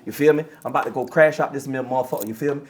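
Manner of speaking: low, loose imitation of local American talk; local down-here accent. Shown as a demonstration. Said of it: "I could talk like y'all down here".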